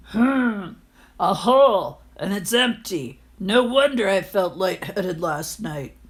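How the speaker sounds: lightly voiced grumbling old man; grumpy landlord. Landlord finds a hole in his head, mild grumble. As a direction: muse over it like a sour miser.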